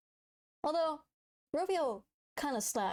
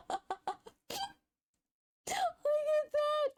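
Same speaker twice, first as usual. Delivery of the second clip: excited; much slower than usual. Real, not acted. Laughing at drawing.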